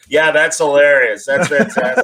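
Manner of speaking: mock hearty reaction breaking into laughter; imitated clueless laughing. Editor laughing at Mark's sad news, mock laughing. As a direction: imitate someone laughing along without understanding. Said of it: "would just start laughing".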